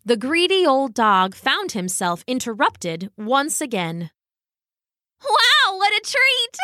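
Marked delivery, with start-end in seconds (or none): 5.2-6.6 a high, bright-eyed squeal of delight; chirpy duck. A narrator's reading. The plucky duck spots the bone, squealing with delight.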